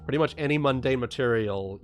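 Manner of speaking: ordinary talk, nothing marked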